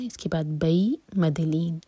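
usual speaking voice

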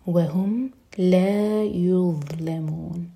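very slow, with the final vowel of یظلمون held long; lengthened ending. Shown as a demonstration. Teacher showing the stretched ending to students, slow. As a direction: hold the وُن of یظلمون out at the end. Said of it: "اینڈ میں مد عارض للسکون".

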